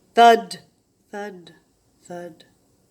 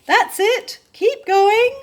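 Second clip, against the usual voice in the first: much higher, loud call from the back; 13 semitones higher, 10 dB louder, slower than usual. A narrator's reading. Lion calls from the back of the line, loud. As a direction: cheer them on from behind. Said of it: "called Ralph from the back".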